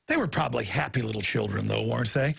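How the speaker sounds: low, dry mock-sweet sarcasm; sarcastic. Real, not acted. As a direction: sneer it with fake sympathy. Sarcastic aside about camp children.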